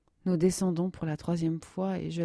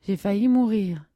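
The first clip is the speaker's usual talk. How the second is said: louder, higher, still shaken; terrified; 4 semitones higher, 7 dB louder than usual. Acted. Nadia, shaken after the sled ride, frightened. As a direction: blurt it out in fright. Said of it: "avec de grands yeux pleins de terreur".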